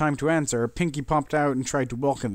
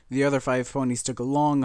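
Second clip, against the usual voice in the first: ordinary delivery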